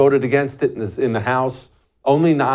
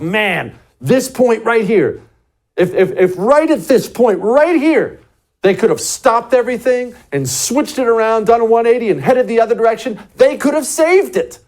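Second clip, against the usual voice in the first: high, emphatic exclamation of regret; acted-out exasperation at a missed chance; exasperated hindsight; 9 semitones higher, 3 dB louder than usual. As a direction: plead with the past, pitch climbing. Acting exasperated hindsight to oneself.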